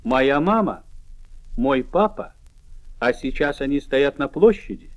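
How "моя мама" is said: ordinary talk, nothing marked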